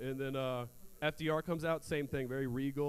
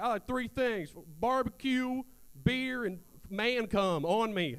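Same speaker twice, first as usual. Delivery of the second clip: Southern guy voice; 6 semitones higher, slower than usual. Acted. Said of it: "we need a Southern guy".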